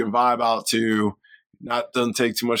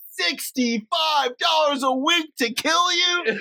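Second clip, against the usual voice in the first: mock outrage; much slower than usual. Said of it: "He was like".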